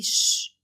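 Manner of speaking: loud, sudden shushing sound; sharp shush. A narrator's reading. Husband silences wife so his mother won't hear, shushing. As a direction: hush her urgently.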